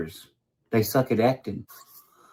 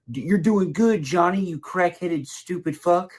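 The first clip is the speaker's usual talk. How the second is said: higher, patronizing mock praise; mocking Ronnie; 6 semitones higher, slower than usual. Mocking Ronnie egging Johnny on, higher and patronizing. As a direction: pat him on the head with words.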